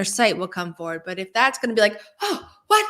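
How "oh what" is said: high-pitched startled gasp of disbelief; mock shock. Higher self's sight giving someone a fright, mock shock. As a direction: act out a shocked double take. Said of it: "Have a shocking moment".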